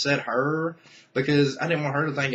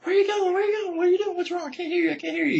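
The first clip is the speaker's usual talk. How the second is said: much higher, pleading imitation of her voice; imitating her calling after him; faster than usual. Friend calling after him leaving the bowling alley, high imitation. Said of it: "and she's like".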